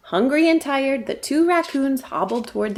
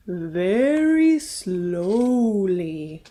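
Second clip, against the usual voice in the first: very slow, drawn-out words; slow tortoise; 2 semitones lower, much slower than usual. Acted. Tortoise telling the raccoons how to eat, very slowly. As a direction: crawl through each word like a tortoise.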